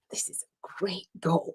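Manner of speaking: slow, hushed whisper; a secret, self-satisfied aside; inner thought whispered. Retold or imitated.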